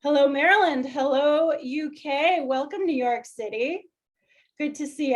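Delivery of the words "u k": normal talking voice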